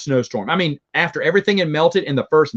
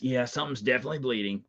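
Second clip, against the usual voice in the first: a softer, light imitation of Batman; Batman line; 2 semitones lower, 11 dB quieter, faster than usual. Quoting Batman's line to chat, a lightly voiced softer Batman. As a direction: murmur it like a hint of the caped hero. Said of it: "That's what Batman says".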